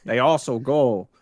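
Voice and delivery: high, hammed-up fake Russian accent; mock Russian accent; bad Russian accent. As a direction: keep up the cheesy Russian accent. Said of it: "That was an awful Russian accent".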